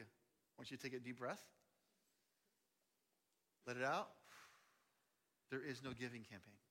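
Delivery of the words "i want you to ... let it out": calming. Shown as a demonstration. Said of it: "here's what I want you to do".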